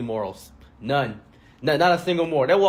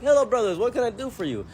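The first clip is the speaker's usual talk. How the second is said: mock friendly greeting; 8 semitones higher than usual. Store owner greeting the three armed men, fake friendly. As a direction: greet them sweetly while gripping the gun.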